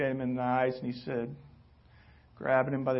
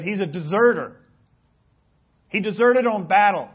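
accusing report; 4 semitones higher, 12 dB louder than usual. A narrator's reading. Accuser reports deserter to king.

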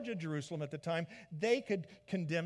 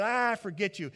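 loud, higher brush-off; dismissive Herod; 5 semitones higher, 8 dB louder than usual. Retold or imitated. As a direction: wave him away in disgust. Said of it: "And Herod said".

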